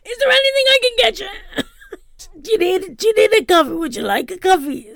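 cheeks sucked in. Retold or imitated. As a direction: gush at her while holding your breath. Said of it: "trying to suck in the cheeks".

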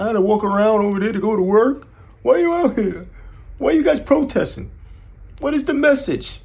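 high-pitched whiny mock voice; mocking clueless complainers. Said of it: "people are so fucking stupid".

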